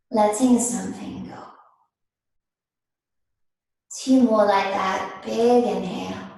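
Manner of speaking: slow, unhurried yoga teacher voice with long pauses; guided meditation. Real, not acted. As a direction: ease them gently into stillness, phrase by phrase. Leads seated breathing meditation.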